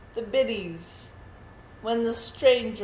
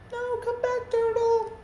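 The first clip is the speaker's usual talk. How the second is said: much higher, pleading call; calling after him. Acted. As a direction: cry out to the leaving turtle in a squeaky plea. Reader calls after the turtle.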